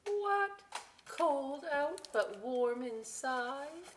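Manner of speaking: high, exaggerated "What?" of disbelief; playful astonishment, then slow and wondering; mock surprise. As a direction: act baffled for the kids.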